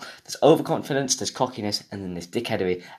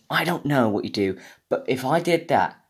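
normal talking voice

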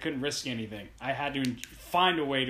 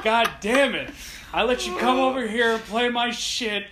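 laughing mock rant, louder and higher; mock outrage; 6 semitones higher, 6 dB louder than usual. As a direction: rant at the friend in playful fury. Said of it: "I'm like".

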